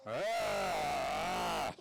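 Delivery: short moo in imitation of a cow. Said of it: "It sounds like this".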